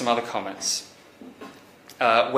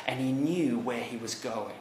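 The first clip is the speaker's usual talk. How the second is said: normal talking voice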